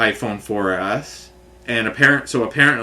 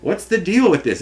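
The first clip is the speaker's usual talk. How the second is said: higher, baffled demand; pitched-up what-gives; exasperated question; 7 semitones higher than usual. Retold or imitated. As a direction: toss it up in disbelief. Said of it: "So then I'm like".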